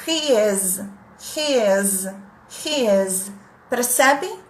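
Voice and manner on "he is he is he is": He is in a near-native English accent, R from the throat, ê and Z; native-like He is with throaty R and buzzing Z. Shown as a demonstration. Teacher contrasts near-native He is with the Brazilian one. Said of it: "Pronúncia perto do nativo".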